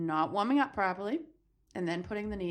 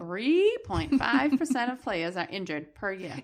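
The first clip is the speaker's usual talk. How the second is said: slow, stretched "Three", then steady delivery; exaggeratedly deliberate; 3 semitones higher, slower than usual. Real, not acted. Host told to spit it out, exaggerated slow reading. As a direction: draw out "Three point five percent" emphatically. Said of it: "Spit it out".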